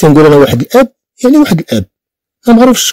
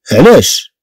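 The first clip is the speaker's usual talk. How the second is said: normal talking voice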